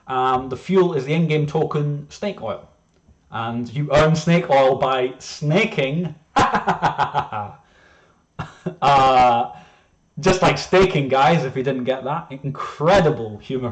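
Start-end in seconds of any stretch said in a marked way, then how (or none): 6.3-7.5 amused laugh at own joke. Real, not acted. Presenter cracks up at own snaking pun, laughing. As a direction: burst of laughter trailing downward.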